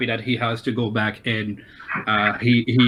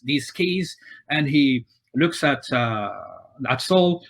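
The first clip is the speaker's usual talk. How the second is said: usual speaking voice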